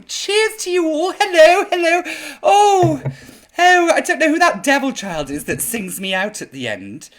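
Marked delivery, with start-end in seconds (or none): none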